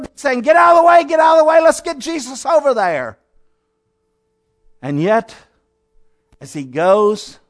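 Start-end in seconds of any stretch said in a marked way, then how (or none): 0.4-3.2 loud, high shout to clear the way; an urgent father shoving people aside; shouting at the crowd. Pretend. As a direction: push through the crowd, yelling. Preacher imagines clearing crowd.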